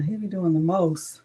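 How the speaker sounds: normal talking voice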